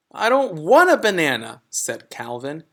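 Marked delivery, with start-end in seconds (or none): 0.1-1.6 put-on higher, louder protesting kid; a boy objecting to being called a monkey; higher child voice, firm protest; child character voice. Calvin voiced in story.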